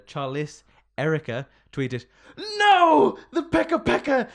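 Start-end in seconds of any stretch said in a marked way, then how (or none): 2.6-4.3 high, loud mock cry of dismay; mock outrage. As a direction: wail it like your bird just lost. Acting a dismayed tweet.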